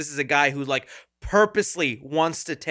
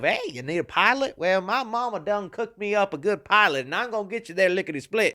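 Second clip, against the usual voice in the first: hammed-up Southern twang; mock Southern good ol' boy; terrible Southern accent. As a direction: do a bad folksy Southern accent. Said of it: "this really terrible Southern accent".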